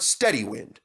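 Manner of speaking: ordinary delivery, nothing marked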